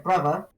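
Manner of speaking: "Brother" with a v for the th, bruvva; Cockney "Brother", the middle turned to v. Shown as a demonstration. Showing the sound change, "Brother" said with a v. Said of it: "more like a v sound".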